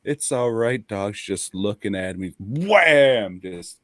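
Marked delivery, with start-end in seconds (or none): 0.0-0.8 higher, gentle mock comfort to a dog; soothing the dog. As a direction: coax the little beagle. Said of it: "it's just there like".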